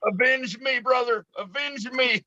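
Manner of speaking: high, strained dying-hero plea; overacted death scene. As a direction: gasp it out like a fallen warrior. Mock dying plea to teammate.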